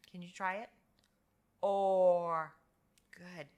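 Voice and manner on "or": "Or" held long and loud; OR sound. Shown as a demonstration. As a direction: draw out "Or" as one sound. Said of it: "it makes the sound".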